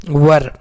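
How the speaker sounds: वर as one syllable, neutral vowel into r; correct "were" pronunciation. Shown as a demonstration. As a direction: say वर like "wur", no ee or ay. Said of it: "क्या पढ़ेंगे".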